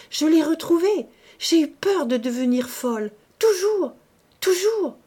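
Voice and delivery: high, loud cries of dread; distraught. A narrator's reading. Woman confesses her terror of going mad, distraught. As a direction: cry out the never-ending fear.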